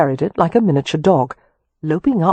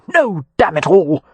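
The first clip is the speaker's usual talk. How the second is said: slow, louder angry outburst; furious; 2 semitones higher, 4 dB louder than usual. A narrator's reading. Leo cursing the unlabelled vial, furious. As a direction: spit the curse out in fury. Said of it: "gripped with volcanic fury".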